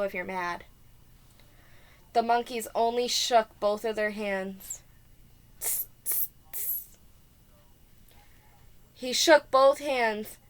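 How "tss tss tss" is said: unvoiced tss sounds; monkey tss hiss. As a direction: hiss back like cheeky monkeys. Monkeys' reply.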